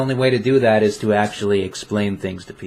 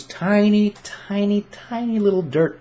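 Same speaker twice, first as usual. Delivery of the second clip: much higher, slower on each tiny; squeaky tiny; 10 semitones higher than usual. Real, not acted. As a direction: let the pitch climb to show how small. Stressing smallness of paths.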